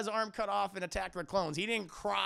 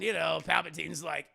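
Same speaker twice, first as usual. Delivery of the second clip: usual speaking voice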